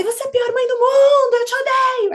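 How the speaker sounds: put-on high, loud child yelling at mom; angry child. Mother voices daughter screaming she hates her, high and loud. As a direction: throw it at mom like a furious kid.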